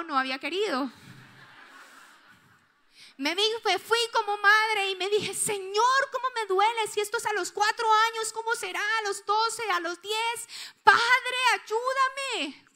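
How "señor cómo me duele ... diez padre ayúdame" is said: higher, louder pleading cry to God; anguished prayer. Retold or imitated. A hurt mother crying out to God for her son, higher and louder. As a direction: plead with God, voice rising in pain.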